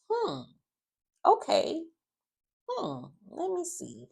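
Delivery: high, drawn-out suspicious hum; mock skeptical okay sung up high; wary, playing along slowly; suspicious hmm. Kat suspicious, voiced high.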